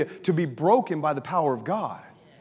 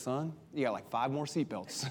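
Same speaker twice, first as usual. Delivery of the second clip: put-on soft, lower, gently teasing the boy; calm with son; 6 semitones lower, 11 dB quieter than usual. Father calmly jokes with his trapped son, soft and gentle. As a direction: talk him down, soft and easy. Calm reply instead of screaming.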